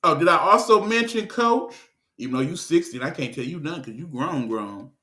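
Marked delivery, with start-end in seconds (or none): 2.2-4.9 low, soft, hurried mutter; grudging aside. Real, not acted. Conceding that Coach is grown, muttered aside. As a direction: drop it right down under your breath.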